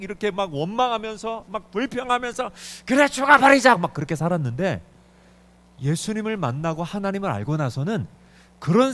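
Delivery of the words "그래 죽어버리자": loud, higher cry of giving up; despairing shout. Retold or imitated. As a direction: fling it out in despair. Mother's despair, quoted. (크고 높게 절규하듯)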